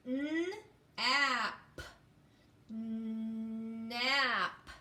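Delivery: N, ap, nap split into sounds then blended; sounding out. Shown as a demonstration. Teacher sounding out a word, sound by sound. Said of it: "make the sounds".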